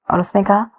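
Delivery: ordinary delivery, nothing marked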